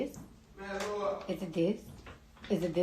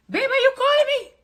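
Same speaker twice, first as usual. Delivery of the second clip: ordinary delivery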